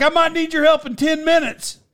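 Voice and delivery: retold call across to a fan; mock calling out. Wrestler from the ring appeasing the fan, calling out.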